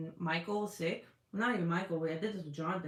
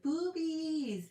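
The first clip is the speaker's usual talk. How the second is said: chat quote; 10 semitones higher than usual. Retold or imitated. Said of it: "Miss Coffee's like".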